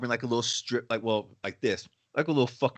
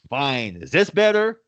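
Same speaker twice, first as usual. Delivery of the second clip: imitated huffy customer, jumping higher and louder; mock exasperated compliance; 4 semitones higher, 6 dB louder than usual. Customer giving in over the mask, mock huffy. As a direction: give in with a put-upon, rising challenge.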